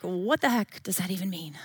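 a hushed, puzzled whisper; whispered confusion. Pretend. Churchgoer baffled by barley harvest, whispered.

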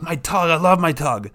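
lightly voiced happy dog; dog's voice. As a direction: gush like a dog over its toy. Voicing dog loving its tug.

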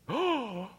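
high dismayed gasp; mock child's gasp. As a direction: imitate a shocked little kid gasping. Child hears puppy threat.

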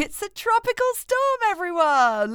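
high, excited call to a crowd; mock-cheery announcement. Host rallying party guests in the storm, mock-cheery. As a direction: sell the disaster as a treat, pitched high at first.

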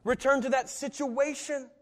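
commanding call. Acted. God's voice commanding the hearer to return, loud and high. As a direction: send them back with force. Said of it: "sometimes he'll even say".

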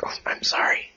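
soft voiceless sorry; whispered apology. Acted. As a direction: whisper it so nobody hears. Hushed rats, Elliot apologizes.

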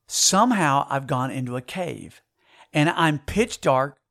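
ordinary talk

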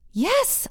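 "Yes" pitched high and firm; emphatic. Retold or imitated. Woman confirming to her lover, emphatic "Yes" pitched high. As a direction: punch "Yes" with a big jump in pitch. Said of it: "I say emphatically".

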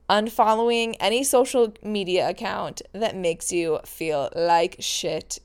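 ordinary talk, nothing marked

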